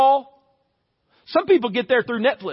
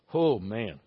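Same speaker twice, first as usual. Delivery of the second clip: low, soft, sinking dismay; dismayed groan; 14 semitones lower, 8 dB quieter than usual. Acted. Worker hearing the boss wants a morning meeting, dismayed. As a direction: let it drop low and quiet in dread.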